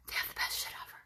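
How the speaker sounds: soft hushed tip; whispered aside. Real, not acted. Telling a viewer about Walmart in a hushed whisper. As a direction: lean in and whisper it.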